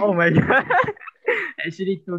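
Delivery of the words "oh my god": real laugh in the middle of the words; amused, laughing outburst; laughing exclamation. Real, not acted. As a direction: exclaim through a louder laugh. Laughing at own lost games.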